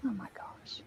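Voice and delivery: whispering. Real, not acted. Embarrassed, teased about underwear.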